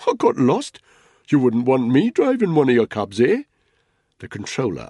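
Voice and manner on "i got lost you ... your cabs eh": loud, high smiling excuse; jovial bluff. A narrator's reading. Spud excusing himself to the angry controller, loud matey bluff. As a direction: laugh it off loudly, rising to a question.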